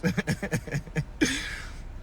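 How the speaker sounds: soft laugh running out of breath; trailing laugh. Real, not acted. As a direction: chuckle away at your own remark. Laughs after own remark.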